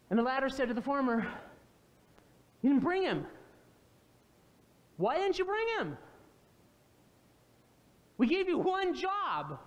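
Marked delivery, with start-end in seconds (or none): 2.6-3.3 exasperated scolding. Retold or imitated. Pharisees scold their officers. 5.0-6.0 pitched high, "bring" pushed with disbelief; exasperated demand. Retold or imitated. Pharisees demanding of their officers, exasperated and pitched high. 8.2-9.6 exasperated scolding. Retold or imitated. Pharisees scold their officers.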